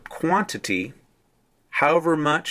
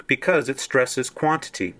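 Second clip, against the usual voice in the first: ordinary talk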